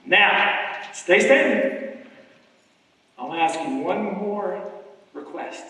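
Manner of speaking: loud, high fired-up crowd rallying over music; hype rally shouting. Acted. Speaker urging the audience to come forward, shouting with fire. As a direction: whip the audience up and pull them to the stage.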